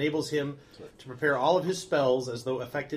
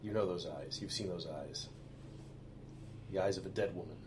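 eerie narration; 6 semitones lower, 8 dB quieter than usual. A narrator's reading. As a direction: draw them into a creepy reveal. GM eerie description.

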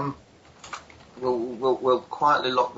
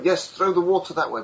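higher-pitched mock helper directing the firefighting; giving instructions; 6 semitones higher, 3 dB louder than usual. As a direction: direct people from a safe distance. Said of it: "try and give basic instructions to people".

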